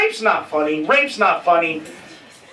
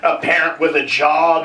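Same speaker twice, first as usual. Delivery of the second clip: ordinary talk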